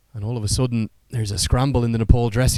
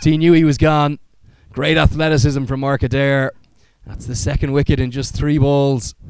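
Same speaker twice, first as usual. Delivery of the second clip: raised, lively call of a run out; excited cricket commentary; 4 semitones higher, 4 dB louder than usual. Real, not acted. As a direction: call the wicket with rising excitement. Live play-by-play of run out.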